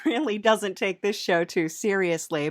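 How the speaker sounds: ordinary talk, nothing marked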